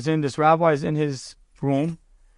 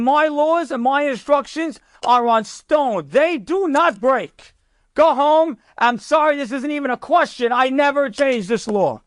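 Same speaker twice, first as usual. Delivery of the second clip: retold angry dismissal, hammering each phrase; angry general; 8 semitones higher than usual. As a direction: act out a furious general refusing outright. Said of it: "he's got so angry".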